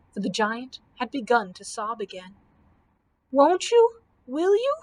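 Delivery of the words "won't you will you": high, loud rising questions; sniffling. A narrator's reading. Foolish giant hoping to be spared, sniffling. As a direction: ask in a childlike, mock-tearful plea. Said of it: "sniffled the foolish giant".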